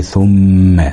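ثم with the doubled م hummed long; held ghunna. Shown as a demonstration. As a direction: linger on the م in ثم. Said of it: "أو على الميم المشددة". (ثم بغنة ممدودة)

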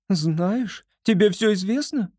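high, quick upset squirrel. A narrator's reading. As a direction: rush it, high and anxious.

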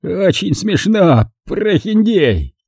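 understated strangled voice; strained voice. A narrator's reading. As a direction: hold back the rage, slowly. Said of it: "сказал он сдавленным голосом".

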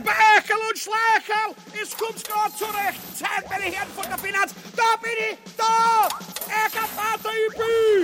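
sehr hoch und laut rufen; aufgeregt kreischend. Acted. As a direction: triumphierend die Helfer herbeirufen. Ubu ruft seine Finanzleute. (schrill gerufen, viel höher und lauter)

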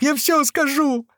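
very high, slow drawn-out wail; yelling in pain. A narrator's reading. Captive clutching his side gives in, yelling in pain. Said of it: "заорал тот от боли".

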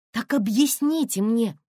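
громко, высоко, с расстановкой; потребуй ответа, выделяя каждое слово; требовательно. A narrator's reading. Тонечка требует объяснений. (требовательно, с возмущением)

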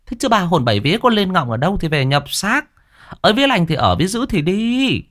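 high keening chant to call the soul back; wailing soul-calling. A narrator's reading. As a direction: wail it out, summoning a lost spirit. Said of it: "vừa khua khua cái nong vừa gào".

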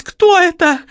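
high, loud shocked question; startled cry. A narrator's reading. Lola recoils from the body, asking in shrill alarm. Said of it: "вскрикнула Лола, отшатнувшись".

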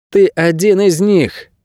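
put-on high, slow child's voice turned cold; icy boy's voice. A narrator's reading. Boy accusing the man he aims his bow at, icy. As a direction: speak as a high-voiced boy, icily and slowly. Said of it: "сказал мальчик ледяным голосом".

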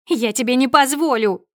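high, loud forbidding cry; furious protest. A narrator's reading. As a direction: shout to stop him signing. Wife tries to stop him signing. (яростный громкий протест)

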